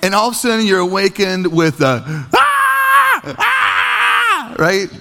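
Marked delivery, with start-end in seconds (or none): 2.3-4.5 put-on piercing high child's scream; two long, high screams. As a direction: scream like a five-year-old in the night. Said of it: "is screaming with such intensity".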